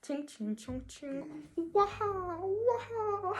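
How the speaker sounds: mock sing-song syllables climbing to high cries; mocking imitation. Boys mocking the K-pop fans' music, jeering imitation. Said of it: "On va dire, euh".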